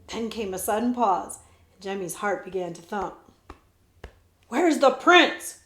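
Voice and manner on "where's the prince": slow, loud, angry snap; snapping. A narrator's reading. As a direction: bark the question at them. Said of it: "Cutwater snapped".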